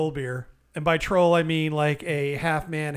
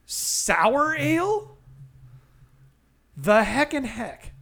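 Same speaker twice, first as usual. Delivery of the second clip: dismayed surprise; 8 semitones higher, much slower than usual. Retold or imitated. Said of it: "And I turn".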